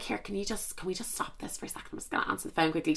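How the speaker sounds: very soft, hurried murmur; hushed aside. Retold or imitated. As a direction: ask it under your breath, quickly. Hushed aside before answering phone.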